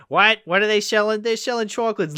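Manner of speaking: put-on whole marching band parodying the chocolate old lady, high squawk. Hammed-up high old lady impression. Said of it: "every member of the band started doing, started parodying him".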